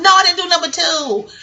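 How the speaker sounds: high, loud retold protest; indignant denial. Woman denying it to her family, indignant.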